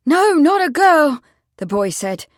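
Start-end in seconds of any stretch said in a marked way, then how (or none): 0.1-1.2 put-on high, loud boy's voice; excited. A narrator's reading. The boy corrects the constable, excitedly. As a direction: correct him eagerly at full volume.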